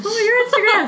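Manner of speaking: excited. Real, not acted. Excited echo of friend's remark.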